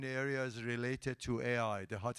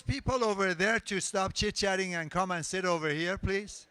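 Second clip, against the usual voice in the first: raised voice, pitched higher, carrying across the room; louder, higher call to the back of the room; 6 semitones higher, 4 dB louder than usual. Real, not acted. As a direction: call over the crowd to the chatting group. Host calls across the room.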